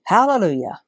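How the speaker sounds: usual speaking voice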